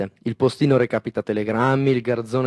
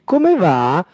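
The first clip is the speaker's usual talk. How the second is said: mock greeting; 7 semitones higher, 6 dB louder than usual. Mario mimicking a greeting.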